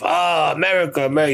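high-pitched, boastful imitation of the village chief; bragging village chief.

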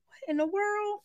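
high, soft "what in the world"; hushed amazement. Retold or imitated. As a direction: breathe it out, stunned. Finding identical women in dream.

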